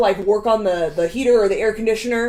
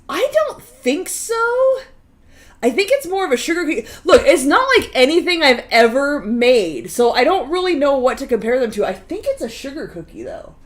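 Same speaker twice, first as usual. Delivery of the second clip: ordinary talk, nothing marked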